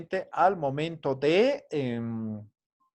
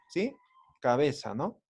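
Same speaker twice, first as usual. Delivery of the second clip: usual speaking voice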